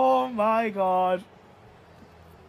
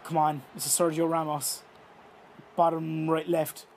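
normal talking voice

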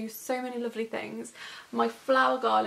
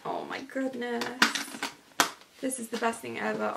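ordinary talk, nothing marked

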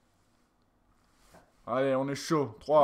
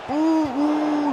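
excited hoot; 9 semitones higher than usual. Real, not acted. Gets excited at an attack.

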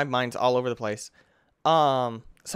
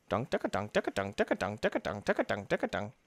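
playful imitated pounding; 5 semitones lower, 7 dB quieter, faster than usual. Player crushing things, making playful pounding noises.

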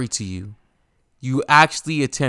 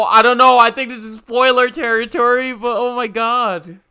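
much higher and louder, overwhelmed disbelief; stunned and overwhelmed; 12 semitones higher, 7 dB louder than usual. Real, not acted. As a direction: blurt it out, floored by what you just saw. Stunned by a spoiler scene.

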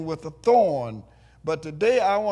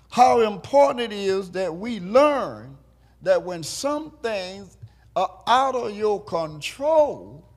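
stretched, sing-song preaching; building sermon; 5 semitones higher than usual. Acted. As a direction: lift the congregation toward the point. Preaching climax.

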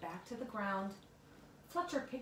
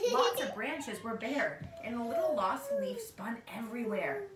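ordinary delivery, nothing marked

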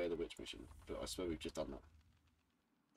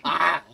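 loud, high yelp; short startled scream, far louder than talk; sudden scream; 11 semitones higher, 17 dB louder than usual. Real, not acted. Player caught out mid-game, sudden loud scream.

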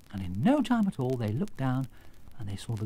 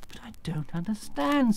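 high, breath-filled puzzlement; bewildered; 9 semitones higher than usual. Acted. Girl confused by the wizard's confession, bewildered. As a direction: puzzle over it in a high, light voice. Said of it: "said Dorothy in bewilderment".

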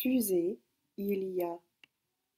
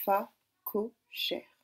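"Fa cochère" très lent, trois syllabes séparées; répéter "Fa cochère" lentement, fa... co... chère; découpé en syllabes; slower than usual. Shown as a demonstration. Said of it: "Donc on écoute bien". («Fa cochère» répété lentement, une syllabe à la fois)

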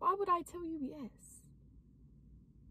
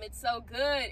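normal talking voice

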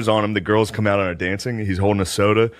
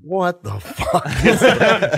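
laughing disbelief; 7 semitones higher, much slower than usual. Retold or imitated. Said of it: "everyone in the room was just like".

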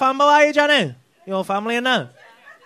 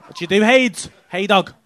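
ordinary talk

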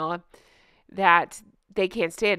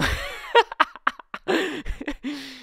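laughing; 6 semitones higher than usual. Real, not acted. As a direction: burst out laughing. Laughing at mask slip clip.

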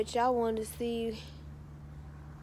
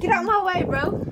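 pushy shout; 8 semitones higher, 8 dB louder than usual. Retold or imitated. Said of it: "They be like".

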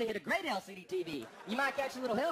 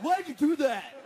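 louder, higher imitation of an angry friend; mock-outraged yell, pitched up; angry outburst; 4 semitones higher, 8 dB louder, slower than usual. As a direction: burst out with an indignant, hammed-up protest. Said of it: "when he's really angry at me".

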